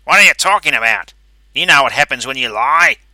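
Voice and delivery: loud, high, menacing threat; angry. A narrator's reading. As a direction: threaten him sharply. Pinky threatens the Brain.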